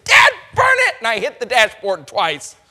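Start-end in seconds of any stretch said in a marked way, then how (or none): 0.0-0.9 very high, loud yell; shouted curse. Acted. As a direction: blow up at the traffic. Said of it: "and I said".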